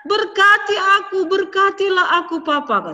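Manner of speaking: high, loud tearful begging; crying plea. A narrator's reading. Esau begging his father for a blessing, crying. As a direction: plead through tears. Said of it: "katanya. Dia nangis".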